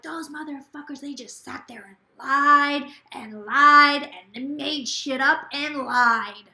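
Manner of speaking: loud, slow furious rant, imitating; ranting old woman. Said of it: "She was going off".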